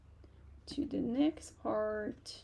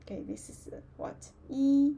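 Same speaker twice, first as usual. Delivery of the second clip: normal talking voice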